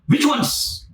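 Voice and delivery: high, eager repeated question; eager excitement. Retold or imitated. Rich man eagerly pressing Jesus, high and keen. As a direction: pitch it up like he can't wait. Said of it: "Look at the tone".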